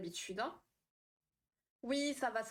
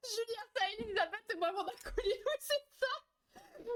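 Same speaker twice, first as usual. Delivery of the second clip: high-pitched giggly words; giggling; 12 semitones higher than usual. Real, not acted. Laughing at chat about her friend Elisabeth, high and giggly. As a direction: laugh through the names.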